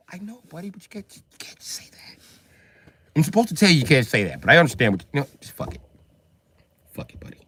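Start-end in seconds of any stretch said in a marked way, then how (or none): 0.1-2.2 whispered, conspiratorial aside; whispered half-hearted warning; quiet warden. Acted. 5.2-7.4 hushed, resigned whisper; whispered shrug; whispered giving up. Acted. Warden gives up, whispered.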